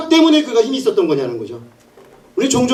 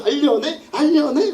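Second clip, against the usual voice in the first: high, repetitive mock pleading; nagging; 6 semitones higher, slower than usual. Woman pestering Samson daily, high acted nagging. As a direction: pester in a high whiny pitch. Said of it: "졸으면서".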